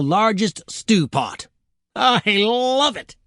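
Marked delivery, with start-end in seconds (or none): 1.9-3.2 high, drawn-out "love"; gleeful devil squeal. Acted. Devil delighted by the stew pot, gleeful squeal. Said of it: "screamed the devil".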